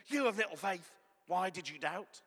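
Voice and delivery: loud, stern scolding; stern rebuke. Retold or imitated. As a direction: bark it as a harsh rebuke. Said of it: "I don't think he said it like that at all".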